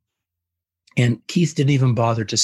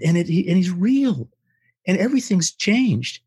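much higher, gushing with wonder; ecstatic Keith; 8 semitones higher than usual. Retold or imitated. New convert gushing to his wife on the drive home, ecstatic and much higher. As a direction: burst out like a new believer overflowing with joy. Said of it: "and he's so excited".

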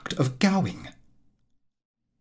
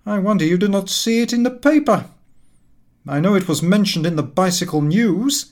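higher, louder put-on voice of a sulky sick friend; aggrieved invalid; 6 semitones higher, 4 dB louder than usual. A narrator's reading. Cummings complaining to his neglectful friend, aggrieved and loud. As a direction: whine out the grievance, raised and loud.

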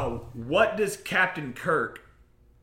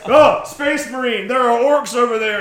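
panicked guardsman; 6 semitones higher, 9 dB louder than usual. Retold or imitated. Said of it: "guardsmen are all like".

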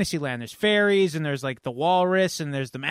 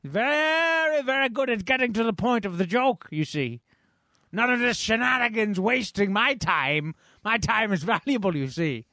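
a high, haughty put-on voice; pompous high voice; 4 semitones higher than usual. Pompous character taunting his rival, high and haughty. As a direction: lord it over him, sneering.

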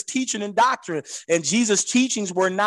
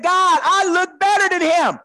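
vain devil; 11 semitones higher than usual. Acted. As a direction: crow like a preening devil who thinks he's gorgeous. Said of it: "The devil said".

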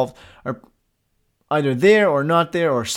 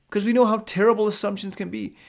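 normal talking voice

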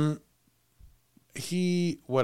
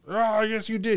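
mock angry retort; 8 semitones higher than usual. Dad contradicting kids over open windows, mock angry retort. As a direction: snap back as a mildly mocked angry dad. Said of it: "he would scream at the children".

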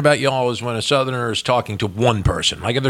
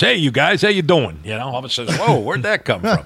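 put-on New Jersey accent; 3 semitones higher than usual. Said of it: "and I'm sure it's".

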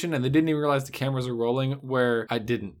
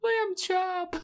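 squeaky voice; 4 dB quieter than usual. Pretend. Silly high voice quip.

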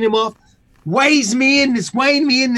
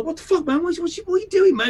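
high, indignant what-the-fuck, pitched way up; mock confrontation; 9 semitones higher, faster than usual. Confronting the guy who bumped him in Lids, high and indignant. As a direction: call him out, rising in outrage.

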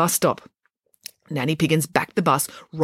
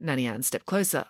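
ordinary delivery, nothing marked

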